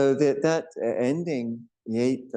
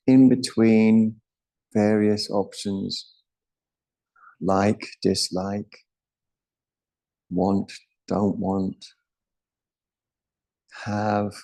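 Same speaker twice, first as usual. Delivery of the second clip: meditative teaching; 2 semitones lower than usual. Acted.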